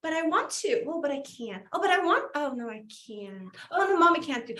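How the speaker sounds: torn back and forth, high then sinking; torn, mock pleading. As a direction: act out wanting it, then giving in. Acts out self torn between wanting.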